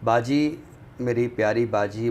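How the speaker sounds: ordinary delivery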